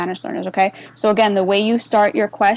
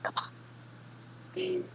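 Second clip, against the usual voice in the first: barely audible, higher-pitched urging; impatient mutter; 10 semitones higher, 21 dB quieter than usual. Real, not acted. Teacher waiting for the list to update, impatient mutter. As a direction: coax it under your breath.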